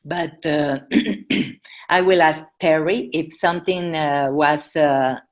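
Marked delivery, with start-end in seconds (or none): none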